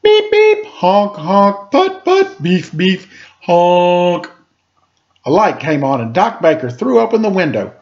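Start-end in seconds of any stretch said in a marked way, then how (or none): 0.0-4.3 high honking horn noises; loud toy-horn beeps and honks; tractor horn blasts. A narrator's reading. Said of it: "he blared his horn".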